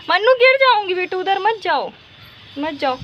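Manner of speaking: mother calling her little daughter back, alarmed. Real, not acted. Calling daughter away from edge.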